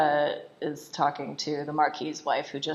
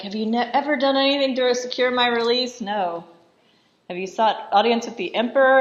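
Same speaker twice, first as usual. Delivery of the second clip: raised, louder retold interrogation; mock yelled accusation; 7 semitones higher, 6 dB louder than usual. Marquis questioning wife about his release, mock angry yelling. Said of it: "he's yelling at her".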